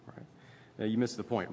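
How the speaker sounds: normal talking voice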